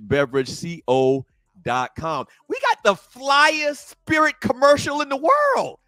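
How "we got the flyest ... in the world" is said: high-pitched, drawn-out boast; excited hype. Real, not acted. Host bragging about the sponsor's commercial, excited hype. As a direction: hype it up with a soaring voice.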